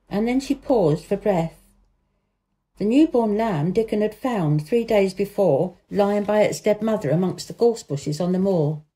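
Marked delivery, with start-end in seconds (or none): none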